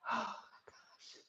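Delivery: barely voiced, breathless gasp; strained breathy gasp. Real, not acted. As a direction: gasp it out, nearly no voice. Straining in wall squat.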